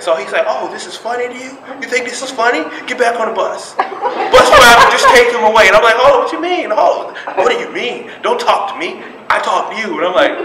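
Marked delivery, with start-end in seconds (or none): 0.5-3.7 high-pitched, menacing challenge; imitated instructor's indignant needling; mocking drill instructor. 3.8-5.7 very high, loud holler; imitated drill instructor's yell; calling out. Instructor calls out to bus driver. 6.0-10.0 high-pitched heated back-and-forth; imitated argument with a drill instructor; indignant confrontation. Retold clash with instructor.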